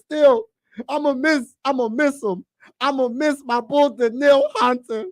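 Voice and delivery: high whiny lament; sad lament. Real, not acted. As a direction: mourn a favorite player leaving. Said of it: "I'm sad about that".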